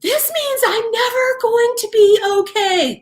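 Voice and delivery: very high, whiny tantrum voice; mock stink fit. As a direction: wail it like a dramatic self-pitying tantrum, exaggerated. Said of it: "throwing a stink fit and being depressed".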